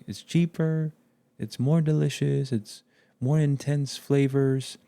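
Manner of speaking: high, bright ad pitch voice; advertiser voice. Retold or imitated. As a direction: hype it like a commercial. Voicing junk food sales pitch.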